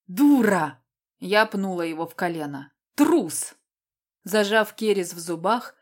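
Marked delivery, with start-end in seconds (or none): 0.1-0.8 loud, angry bark of an insult; angry growl. Acted. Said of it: "прорычал". 2.9-3.5 high, loud insult hurled back; angry shout. Acted. Insult thrown back in quarrel.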